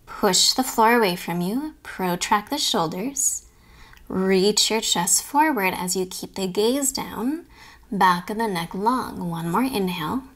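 ordinary delivery

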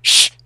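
sharp loud shushing hiss; urgent shush. Acted. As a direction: cut him off with a shush. They'll hear you outside.

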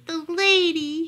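high calling cry of a lost dog; plaintive call. A narrator's reading. Worried dog out in the rain, calling plaintively. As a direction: call out high for your owner. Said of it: "he called".

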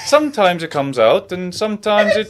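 wobbly comic Welsh accent. Shown as a demonstration. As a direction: attempt Welsh and let it slip towards Viking.

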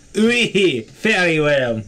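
put-on high, loud mangled Russian accent; Russian accent slipping Middle Eastern. Player giving in theatrically, Russian accent slipping Middle Eastern. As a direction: let the mock Russian drift toward Middle Eastern. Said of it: "that one's kinda devolving into Middle Eastern".

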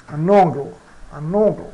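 un ongle, the un sounding like an. Shown as a demonstration. Teacher showing how the E sounds like A. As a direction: say un ongle with the vowel as A. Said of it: "ele soa como A".

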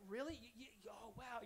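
high, stammered mock surprise; playing dumb. [name] pretends he didn't know, in mock surprise. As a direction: act amazed so she keeps going. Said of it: "I'm playing dumb now".

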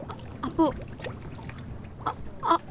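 breathless stammering whisper; stunned whisper. Acted. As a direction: gasp the words out in shock. Wife hears bad news.

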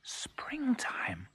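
hushed whisper of wonder; soft, breath-only cuckoo voice. A narrator's reading. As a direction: whisper it softly, as if hardly daring to believe it. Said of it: "whispered the cuckoo".

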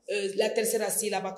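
ordinary talk, nothing marked

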